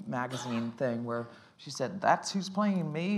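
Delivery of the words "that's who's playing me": light Southern drawl, slow and stretched; Southern accent. Retold or imitated. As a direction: mimic her in a mild Southern accent, dragging the words. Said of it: "I'm playing her Southern right now".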